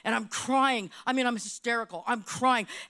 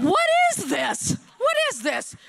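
incredulous outburst; 7 semitones higher, 3 dB louder than usual. Retold or imitated. Restaurant owner confronted by the realtor, high indignant disbelief. Retold shocked reaction to realtor.

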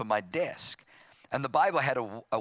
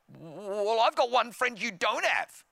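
acted tearful defiance, pitched up high; upset retort; 9 semitones higher, slower than usual. Stung teenager answering his brother back, upset retort.

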